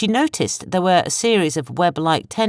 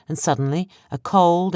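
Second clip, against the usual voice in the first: plain reading voice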